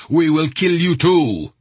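loud, high-pitched threat; angry threat. A narrator's reading. Gunman pointing his gun at the Saudi soldier, threatening.

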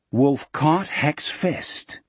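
ordinary delivery, nothing marked

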